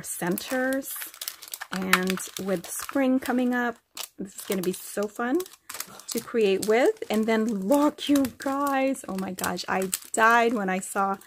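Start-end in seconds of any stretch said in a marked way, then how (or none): none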